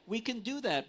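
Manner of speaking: usual speaking voice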